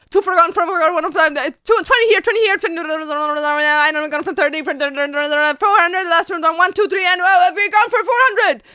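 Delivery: high, loud rapid auctioneer babble; stream persona hype. Shown as a demonstration. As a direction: rattle it off like selling a warehouse. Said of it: "Like, as an example, me".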